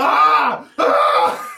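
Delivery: high loud laughing scream; mock scream. Player screams along with the nurse, laughing mock shrieks. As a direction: join in the screaming, half laughing. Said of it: "I would also like to start screaming".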